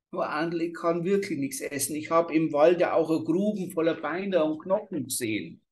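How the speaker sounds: put-on a scared child begging off; frightened child. A narrator's reading. Girl tells the witch what she saw, pleading.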